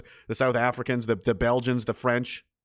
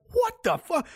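shocked disbelief; slower than usual. Retold or imitated. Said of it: "and I was like".

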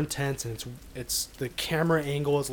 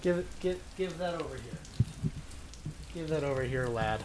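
gruff sailor; 8 dB quieter than usual. Pretend. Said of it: "I gotta get in character".

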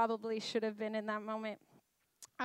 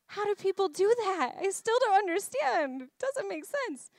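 high-pitched, incredulous protest; hammed-up bewilderment; 9 semitones higher, 4 dB louder than usual. Mother of four still amazed at childbirth, bewildered. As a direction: squeal it out in mock disbelief.

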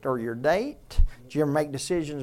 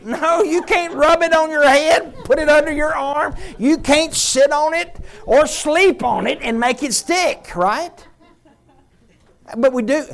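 full-force preaching; 9 semitones higher, 11 dB louder than usual. Acted. Pastor urging the congregation toward the truth, preaching hard. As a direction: drive it home like a revival preacher.

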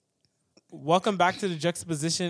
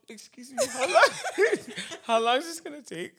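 high, laughing imitation; giggly, pitched-up impression of asking; mock polite query; 6 semitones higher than usual. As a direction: crack up while mimicking the question. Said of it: "She's like".

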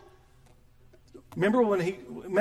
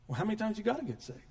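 ordinary talk, nothing marked

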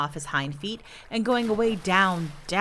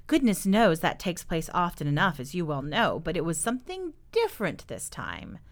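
usual speaking voice

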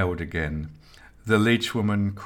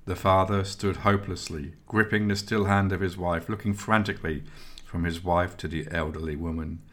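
ordinary delivery

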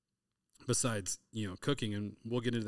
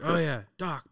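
high, loud mock girl's voice, lightly done; a hint of a young girl calling her dog; imitating daughter's eager reply; 6 semitones higher, 7 dB louder than usual. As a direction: chirp it up like an excited daughter. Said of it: "And she's like, she's like".

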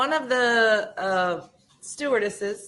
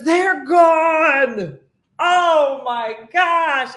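loud, high cry of despair from a stranded runner; man at the gate finds the plane gone, cries out loud and high; dramatic wail; 7 semitones higher, 8 dB louder, much slower than usual. Retold or imitated. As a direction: wail it like all is lost. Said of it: "He goes".

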